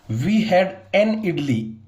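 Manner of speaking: slow dictation reading. Shown as a demonstration. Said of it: "teacher reads example slowly".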